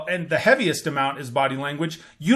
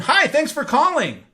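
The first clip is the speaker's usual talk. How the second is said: higher, quick and eager greeting; excited greeting; 7 semitones higher, 3 dB louder than usual. Shown as a demonstration. Sales rep demonstrating an upbeat phone greeting. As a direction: sound thrilled to pick up. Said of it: "you have that rapid voice tone".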